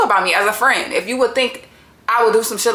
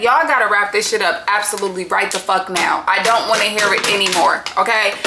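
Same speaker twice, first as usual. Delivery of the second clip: ordinary talk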